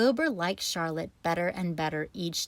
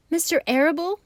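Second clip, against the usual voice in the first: sobbed; 10 semitones higher, 6 dB louder, faster than usual. A narrator's reading. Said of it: "sobbed Wilbur".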